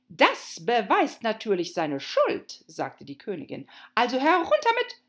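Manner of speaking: high, loud and commanding; furious queen voice. Acted. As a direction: bellow the sentence like a tyrant queen. Queen ordering execution.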